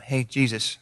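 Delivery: low, soft half-hearted hail; mock a timid, offhand call to someone; casual mumbled greeting. Blind man barely trying to get Jesus' attention, casual and soft. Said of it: "they didn't just go".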